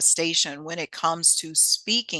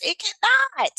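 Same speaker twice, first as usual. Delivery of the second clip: emphatic denial; 13 semitones higher than usual. Real, not acted. Dismissing AI copying her voice.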